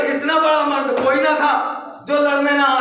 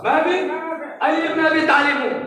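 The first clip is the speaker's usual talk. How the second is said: proud declaration; 6 semitones higher than usual. Acted. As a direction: announce yourself boldly. Ali proclaiming himself before battle.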